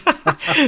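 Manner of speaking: a sudden high burst of laughter; real high-pitched laugh. Real, not acted. Host laughing after an exciting race.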